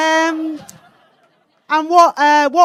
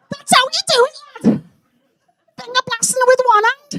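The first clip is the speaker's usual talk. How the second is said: very high, squeaky boasting lad; cocky year-nine boy; 3 dB louder than usual. Acted. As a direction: brag in a strained high schoolboy voice. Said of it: "the cock of year nine".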